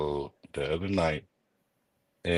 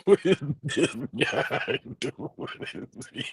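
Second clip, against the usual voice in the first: laughing; 9 semitones higher than usual. Real, not acted. Laughing at the clip.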